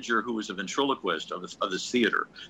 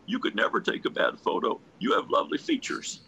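1 charming president; 13 semitones higher than usual. Retold or imitated.

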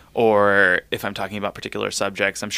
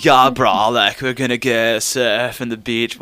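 exaggerated Californian surfer dude; Californian surfer accent; 3 semitones higher than usual.

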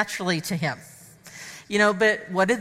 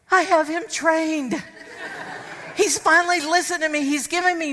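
laughing triumph; 6 semitones higher than usual. Retold or imitated. Wife gloats about training her husband, laughing. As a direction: crow over it with a laugh. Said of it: "first thought went through my head".

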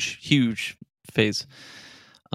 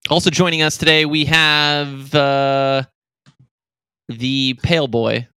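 louder, hyped guest introduction; announcer intro; 3 semitones higher, 5 dB louder, slower than usual. Acted. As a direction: bring on the next guest with flair. Podcast host intro.

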